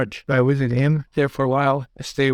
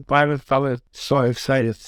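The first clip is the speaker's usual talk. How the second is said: plain reading voice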